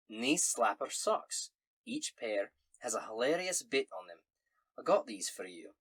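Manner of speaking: hearty Scottish letter-writer; Scottish accent. Acted. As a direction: read the letter aloud in a Scots brogue. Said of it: "in a Scottish accent".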